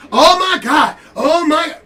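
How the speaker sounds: high, loud mocking shriek of outrage; shrill, over-the-top mock outrage; mock dramatic gasp. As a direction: ham up a drama queen's gasp.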